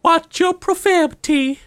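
high, slow prissy scold; mock scolding. Teasing a friend who swore, in a high prissy voice. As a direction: wag a finger in a squeaky voice.

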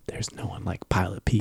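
whispering. Pretend. Dramatic whisper of show tagline.

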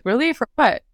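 high-pitched, puzzled disbelief; surprised questioning. Retold or imitated. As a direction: ask it high, as if caught off guard. Acts out her surprise at parents.

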